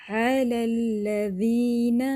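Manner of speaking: slow chant with a light doubled lam; thin lam recitation. Shown as a demonstration. Teacher models علی الذین with a light lam. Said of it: "اسی طرح پڑھ لیں گے".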